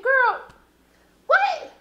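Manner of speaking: put-on high, squeaky girl, what; stunned outburst at the screen; shocked disbelief. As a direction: jump way up in pitch, floored. Shocked as Misty sniffs coke.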